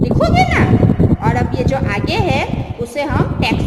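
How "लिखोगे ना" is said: high, louder coaxing question; coaxing check. Real, not acted. Teacher coaxes young pupils, high and louder.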